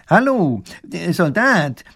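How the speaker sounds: high, drawn-out call to a soldier; hailing professor. A narrator's reading.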